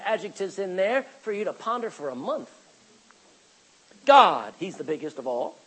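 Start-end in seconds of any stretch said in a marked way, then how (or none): none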